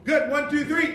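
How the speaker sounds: loud, high, forceful count-in; intense count-off. Real, not acted. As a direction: fire off the count at the players. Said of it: "calm down with your count off".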